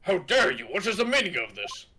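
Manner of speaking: acted indignation. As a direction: demand an explanation, mock-outraged. Game character indignant at court.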